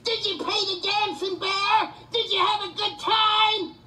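furious sarcastic taunt. Real, not acted. Taunting the callers about their entertainment, furious sarcasm. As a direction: spit the sarcasm at them.

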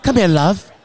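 a hint of an Irish woman calling someone over. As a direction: imitate an Irish woman beckoning. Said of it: "this Irish woman was like".

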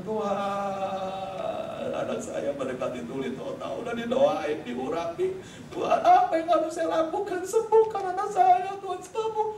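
weeping, begging prayer rising in pitch; tearful prayer. Retold or imitated. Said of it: "Saya nangis, Saudara. Saya mulai berkata".

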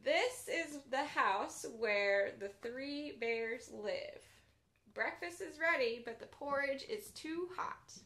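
gentle bedtime storybook read; reading to children. A narrator's reading. Children's librarian reading a pop-up book aloud, gently.

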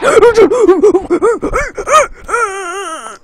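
loud panicked gasp; real startled gasp from a jump scare. Real, not acted. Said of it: "That scared the crap out of me".

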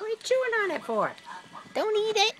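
high-pitched dog-talk voice; playful pet scolding. Real, not acted. Owner telling dog not to eat it, playful.

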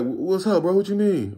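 high, mock-innocent question; playing innocent. Dealer on phone faking innocence to the buyer, high and light. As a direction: sound clueless on purpose. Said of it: "'cause I'm already knowing it's fake".

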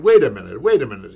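loud call of wait a minute; calling stop. Retold or imitated. Director stopping the reading with a loud halt. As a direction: stop him loudly mid-read. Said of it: "he said".

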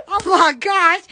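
usual speaking voice

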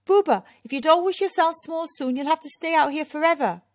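high, loud warning shout; urgent, high-pitched calling. A narrator's reading. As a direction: shout a worried warning across a distance. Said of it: "shouted Moki".

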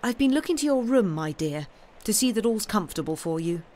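quick, lightly voiced aunt; kindly aunt. Aunt telling Sam about his room, kindly bustle. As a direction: fuss kindly over a guest.

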